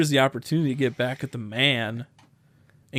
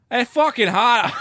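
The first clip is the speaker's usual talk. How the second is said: slow, high mock Boston accent, half-laughing; hammed-up Boston local griping about the heat; 9 semitones higher, 4 dB louder, slower than usual. Friend demands a Boston accent, he hams one up. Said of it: "you gotta do the Boston accent".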